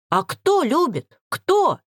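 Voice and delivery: high-pitched angry demand; indignant outburst of questions; cried out. A narrator's reading. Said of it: "закричала".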